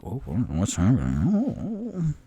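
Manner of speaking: put-on slow, wide-eyed childlike wonder; gleeful kid asking what's going on; dragged-out childish delight. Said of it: "with, like, a little childish, um, glee".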